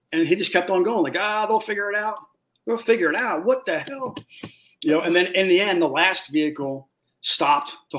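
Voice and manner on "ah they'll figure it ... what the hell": mock dismissive. Mocking the careless driver.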